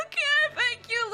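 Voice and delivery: high mock-tearful thanks; retold crying. Client thanking the soothing therapist, mock crying. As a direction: imitate squeaking out words through tears. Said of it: "I broke out in tears".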